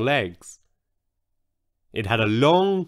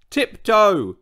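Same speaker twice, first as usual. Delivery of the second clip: high, raised-voice command; bellowing; 7 semitones higher, 4 dB louder than usual. A narrator's reading. Old Triceratops to heavy-footed newcomer, bellowing. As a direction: bellow it as an order. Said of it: "George would bellow".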